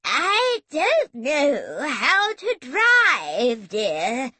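very slow, loud, swooping granny wail; quavering old granny. A narrator's reading. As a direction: draw each word out in a wobbly old voice. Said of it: "Super Granny quavered placidly".

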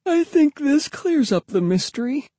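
very high voice for the sister; high girl's voice, meant as sleepy. A narrator's reading. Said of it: "yawning sleepily".

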